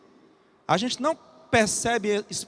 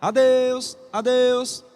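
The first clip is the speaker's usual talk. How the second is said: loud, higher, sustained whistle-like call; train whistle; 4 semitones higher, 6 dB louder, much slower than usual. Acted. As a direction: hold the word on one pitch like a train's whistle. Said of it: "O apito do trem".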